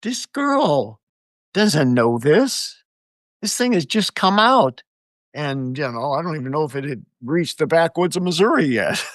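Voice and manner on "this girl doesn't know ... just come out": high-pitched retold disbelief; incredulous self-quote, voice jumping high; retold amazement. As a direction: replay your amazed reaction, pitch lifted. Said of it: "I'm like".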